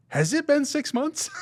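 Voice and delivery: very high, slow, incredulous question; mock bafflement. Viewer questioning the movie's timeline, hammed-up high bafflement. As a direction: squeak it out in exaggerated disbelief.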